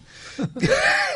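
risa muy aguda y breve; risita aguda. Real, not acted. Risa al contar la explosión. (risita muy aguda y breve)

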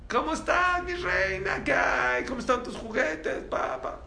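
put-on high, loud gushing baby talk; sweet doting dad voice. Father on the phone to his little daughter, high loving coo. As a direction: coo at your little princess. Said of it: "Cambió la voz totalmente".

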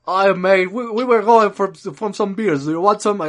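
hammed-up Aussie mate offering beers; mock Australian accent. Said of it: "ponerte a hablar como australiano". (imitando un acento australiano exagerado, más agudo y fuerte)

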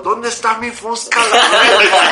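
high, slow, loud imitation of a drunk; mock frantic shout. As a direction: holler while searching, hammed up. Imita al amigo buscando su arma. (grito desesperado, exagerado)

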